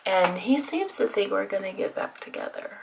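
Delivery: confiding whisper. Real, not acted. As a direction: whisper it like a secret. Confiding about ex.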